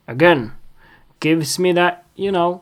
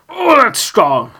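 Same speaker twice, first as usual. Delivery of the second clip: mock jolt; 6 semitones higher, 5 dB louder than usual. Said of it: "that strong taste of coffee is like".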